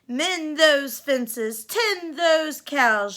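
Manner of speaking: loud, slow barked orders; stepmother yelling. Acted. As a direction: bark chores at her like a mean stepmother. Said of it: "she would yell".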